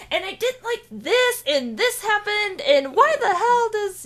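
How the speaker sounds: high, rushed mock rant. Mimicking a friend's high-pitched rant. Mock ranting imitation of herself.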